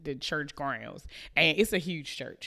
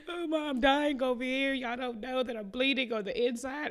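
high, hammed-up whining child; whiny kid; 10 semitones higher than usual. The child complaining to mom in the store, high mock whine. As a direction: whine like a hurt kid nobody believes. Kid pleading after being pinched.

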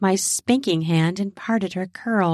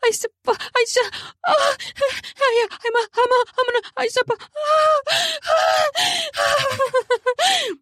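high, gasping broken babble; nonsensical babbling moans. Acted. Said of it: "made nonsensical noises".

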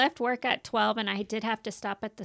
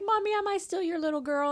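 put-on higher-pitched pleading child voice; tearful little girl; 7 semitones higher than usual. Crying daughter asking her mommy, mock pleading. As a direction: imitate a child whimpering for reassurance.

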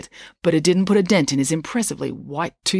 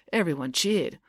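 plain reading voice

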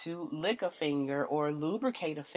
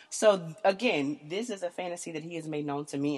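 ordinary delivery

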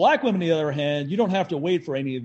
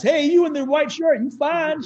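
mock catcall; 12 semitones higher, 6 dB louder than usual.

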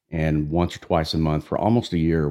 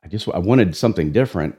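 normal talking voice

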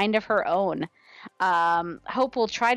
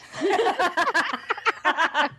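laughing; 7 semitones higher than usual. Real, not acted. Laughing at own joke.

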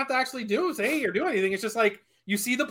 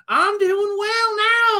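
high, slow mock Southern drawl; changed Southern accent; 10 semitones higher, much slower than usual. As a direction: switch into a stretched-out fake Southern accent. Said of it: "Ninety million dollars would change my accent".

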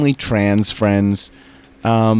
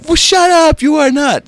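mocking straight guys; 5 dB louder than usual. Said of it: "they were like".

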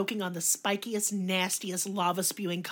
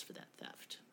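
hushed whispered aside; whispering; 21 dB quieter than usual. Real, not acted. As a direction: drop to a whisper. Disbelieving aside about light punishment.

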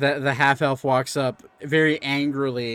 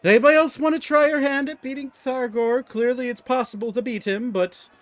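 high, loud showman calling for challengers; rally the crowd like a ringmaster; a hint of a carnival barker; announcer; 10 semitones higher, 3 dB louder than usual. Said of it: "He's like".

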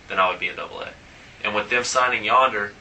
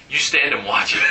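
playful mimicked Latin accent, pitched up; imitated Latin accent; 4 semitones higher than usual. Teammate ordering him to admire his homer, mock Latin accent. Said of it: "in his accent".

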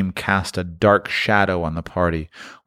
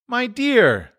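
higher, gentle plea; mild answer. A narrator's reading. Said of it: "was Bob's mild answer".

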